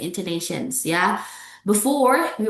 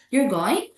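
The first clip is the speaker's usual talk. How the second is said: "going" swept up as a question; unsure question, rising on GOING; rising intonation; 4 semitones higher than usual. Shown as a demonstration. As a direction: lift the pitch sharply on "going". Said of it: "you found the differences".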